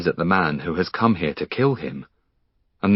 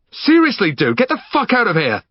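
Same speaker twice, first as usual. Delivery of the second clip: much higher, louder frantic order; panicked yelling; 13 semitones higher, 4 dB louder than usual. Acted. Finn trapped in his hallway, yelling in panic. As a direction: get him out now.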